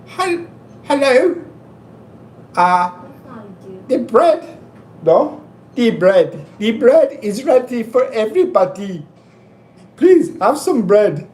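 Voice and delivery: put-on high Indian-accented nanny offering bread. Nanny in an Indian accent offering bread to everyone. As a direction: do Mrs. Doubtfire with an Indian accent. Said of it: "let me try to do it in Indian".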